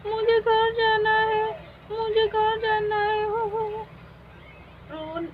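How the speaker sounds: put-on a lost girl sobbing for her mother; crying lost child. Poonam cries to the fairy queen that she is lost.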